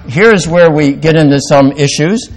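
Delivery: normal talking voice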